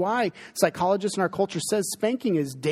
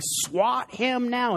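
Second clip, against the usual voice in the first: high, slow mock mom order; mimicking mom; 8 semitones higher, much slower than usual. Mom telling dad to discipline, high slow mock command. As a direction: stretch it out as an exaggerated mom. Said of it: "mom saying".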